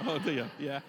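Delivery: chuckling through the words; laughing. Real, not acted. Preacher laughing at Shasti's comment. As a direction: laugh along with the joke.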